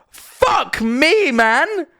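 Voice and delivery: high-pitched, incredulous outburst; exasperated disbelief. Real, not acted. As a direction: protest it high in appalled disbelief. Exasperated at American ambulance costs.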